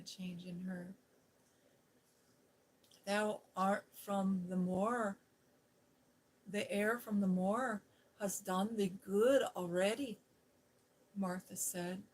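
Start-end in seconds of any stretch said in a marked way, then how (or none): 3.0-10.2 Yorkshire brogue. Acted. Martha to Mary, in a broad Yorkshire brogue. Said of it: "She's speaking in that old Yorkshire brogue".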